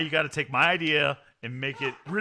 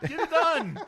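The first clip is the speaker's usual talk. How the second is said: much higher, laughing rallying cry; hyped laughing chant; laughing slogan; 12 semitones higher, 4 dB louder, slower than usual. Real, not acted. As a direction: shout the slogan through a laugh. Slogan repeated, laughing and high.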